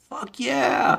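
high, excited mock cheer; hyped crowd cheer. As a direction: cheer it like a pumped-up fan. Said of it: "everybody's like".

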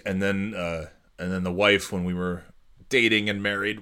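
ordinary delivery

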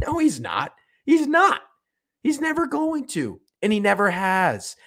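high-pitched, emphatic denial; exasperated rant. Real, not acted. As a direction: insist on it, voice shooting up high. Heated rant answering himself.

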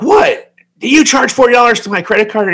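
high, loud mock yell of outrage; retold yell. Son woken up yelling at his mother over a charge, outraged. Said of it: "I yelled at her".

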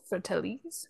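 "Fratellis" questioning, as fra-TELL-eez; unsure pronunciation. Real, not acted. Host unsure of a band's name, tries "Fratellis" as fra-TELL-eez, questioning. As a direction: softly try "Fratellis" with a rising end, stress on TELL. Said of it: "Not sure how to pronounce that".

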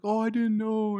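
high-pitched put-on innocence; mock apologetic excuse. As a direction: plead ignorance in a high voice. Acting out an excuse after scolding.

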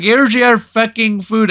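put-on high, loud, irritated Indian accent; mock Indian-accented outburst. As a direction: imitate a cross Indian counterman. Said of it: "the Indian guy caves".

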